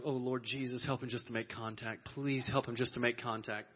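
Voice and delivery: soft, low pleading prayer; earnest prayer. Retold or imitated. Brother kneels at the bench praying, soft and low. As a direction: pray under your breath for him. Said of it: "I'm like".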